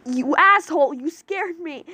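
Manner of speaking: startled outcry. Acted. Lion yelling at Iguro after a scare, startled outcry. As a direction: yell at the friend who scared you. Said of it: "Lion cried as he".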